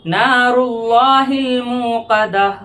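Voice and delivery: slow, sung tajweed recitation; Quran recitation. Shown as a demonstration. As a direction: chant the verse with full tajweed melody. Recites verse melodically.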